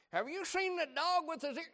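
high, mock goofy texter voice; silly text voice. Preacher mocking a goofball text message, high and silly.

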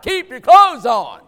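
high, loud mock command; a shouted rule from the pulpit; stern shout. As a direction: bark it at the room like a warning. Repeated command to congregation.

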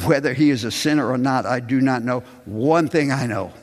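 rising, slowing to now I see; bold testimony. Acted. Reads the healed man's testimony.

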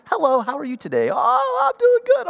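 very high, sing-song fake-sweet mom; mother chirping to a caller after yelling at the kids, high and sugary; sugary phone voice. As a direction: gush it like nothing happened. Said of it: "blissfully angry to ... Hello".

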